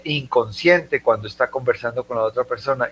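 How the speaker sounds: normal talking voice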